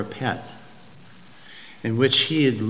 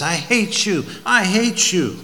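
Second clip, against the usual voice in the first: higher, raised cry of hatred, a bit less wild; screaming again; 6 semitones higher, 4 dB louder than usual. Acted. Boy shouting at his father, refusing to listen. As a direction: hurl it at him again, fingers in ears. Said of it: "he just screams again".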